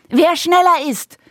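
put-on high, eager child's call; girl calling out. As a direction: call it like a race challenge. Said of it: "rief Mia".

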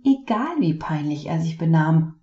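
plain reading voice

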